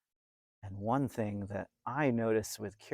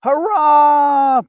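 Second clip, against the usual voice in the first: put-on high, loud child's cheer; shouted hurrah; 22 dB louder than usual. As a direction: shout it out with joy. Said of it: "Bill shouted".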